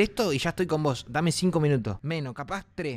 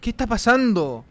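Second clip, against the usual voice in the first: higher, drawn-out question; alarmed cry; 5 semitones higher, 4 dB louder than usual. Real, not acted. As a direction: call it out in alarm. (exclamación alargada de alarma)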